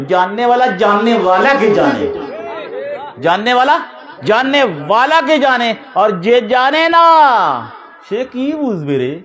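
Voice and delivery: high, rising rhetorical delivery; emphatic preaching. Acted. Preacher telling listeners only the learned know learned men, emphatic. Drives a saying home.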